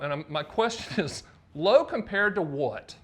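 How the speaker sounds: ordinary talk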